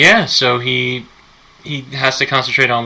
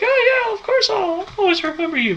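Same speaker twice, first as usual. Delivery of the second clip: high mock British accent, Christopher Robin; lightly hammed British accent, sweet and earnest. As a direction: put on a jolly British accent, pitched up high. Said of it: "but with a British accent".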